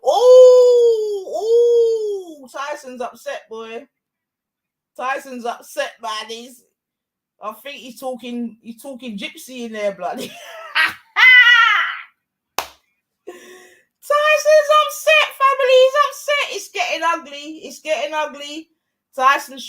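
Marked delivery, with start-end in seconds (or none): 0.0-2.5 loud, high drawn-out ooh; wince-cheer at the punch; excited ooh. Real, not acted. Watching a punch land, excited loud ooh. 10.3-12.0 high burst of loud laughter. Real, not acted. Laughing at the upset fighter, loud laugh. 14.1-16.6 high, loud excited commentary; excited call. Real, not acted. Telling the viewers the fighter is rattled, excited.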